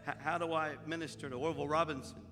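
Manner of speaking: soft, low confessional telling; subdued confiding. Real, not acted. Pastor confiding his grief to the congregation, softly. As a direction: open up quietly to the folks.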